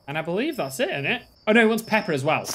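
usual speaking voice

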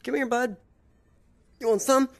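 high-pitched mock challenge; playful taunt. Player calls out a game enemy, taunting in high voice. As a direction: goad them in a squeaky-high voice.

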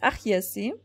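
normal talking voice

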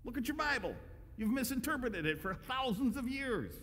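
Jesus correcting the Pharisees, firm and light; lightly voiced Jesus.

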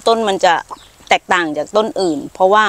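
ordinary talk, nothing marked

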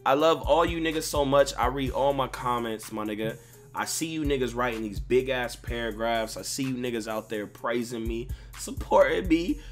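normal talking voice

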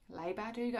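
"Leigh" said softly like "lay"; Leigh as "lay", doubting. Real, not acted. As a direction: try "Leigh" rhyming with "day", unsure and soft. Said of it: "now I'm doubting myself".

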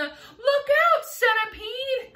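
high, loud alarmed shout; cried warning. A narrator's reading. James warns the dancing centipede, crying out. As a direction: shout an urgent warning to someone in danger. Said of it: "cried James".

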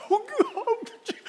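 high, hammed-up tearful prayer; mock crying. Preacher imitating a friend crying at the songs, mock crying. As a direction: imitate a friend overcome with tears in worship. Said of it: "my friend is crying".

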